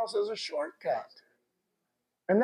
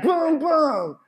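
high, loud booming exclamation; excited outburst; 9 semitones higher, 6 dB louder than usual. Retold or imitated. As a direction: blurt it out high and punchy. Mimes Balaam's excited reaction.

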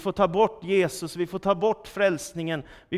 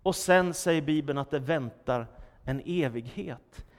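ordinary talk

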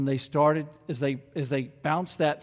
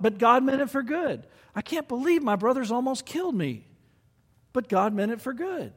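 higher, lightly voiced Joseph musing on his past; voicing Joseph; 9 semitones higher than usual. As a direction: let Joseph marvel, then settle on God's purpose. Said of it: "He could say at the end of every thought".

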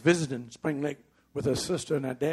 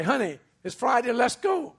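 eager invitation; 8 semitones higher, 5 dB louder than usual. Retold or imitated. Husband eagerly calling to wife.

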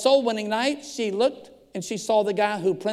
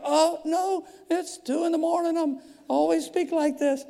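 high-pitched, hammed-up fake awake voice; pretending to be awake; 8 semitones higher than usual. Woken pastor reassures caller, pretending to be awake. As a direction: insist in a put-on high voice that you weren't asleep. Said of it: "you act like you're still awake".

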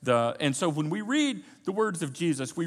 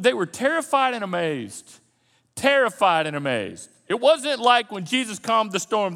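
ordinary delivery

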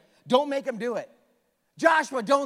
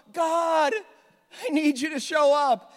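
mock plea to God; 7 semitones higher, 7 dB louder than usual. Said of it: "It's after we screw up".

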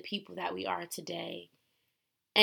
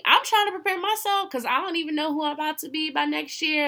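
excited; 9 semitones higher, 6 dB louder, faster than usual. Real, not acted. Hyping up her future self.

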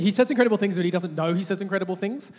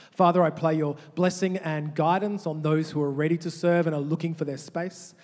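prayer; 2 semitones lower, 3 dB quieter than usual. Acted. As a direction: pray quietly over the church. Prays over the congregation.

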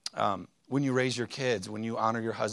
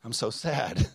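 mock sadness; slower than usual. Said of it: "I didn't say that".

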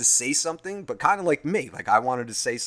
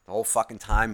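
ordinary delivery

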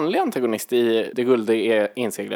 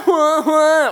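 high loud hua noises; mock sword grunts; 12 semitones higher, 5 dB louder than usual. Imitating a fighter slashing the air, mock grunts.

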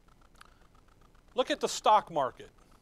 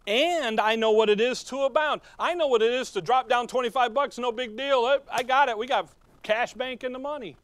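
higher, louder preacher's cry; preaching at full force; 7 semitones higher, 6 dB louder than usual. Real, not acted. Preacher rousing the congregation, full-force preaching. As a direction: lift the congregation with it.

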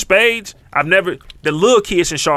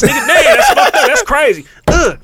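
much higher and louder, breaking into laughter; laughing outburst; 13 semitones higher, 5 dB louder than usual. Real, not acted. Reacting to the domino win, high laughing outburst. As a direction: squeal it out through the laugh.

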